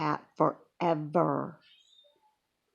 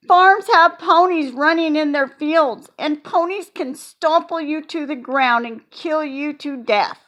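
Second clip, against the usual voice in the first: put-on high, loud little girl insisting; insistent child; 7 semitones higher, 6 dB louder than usual. A narrator's reading. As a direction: warn them dead seriously. Junie B insists ponies dangerous.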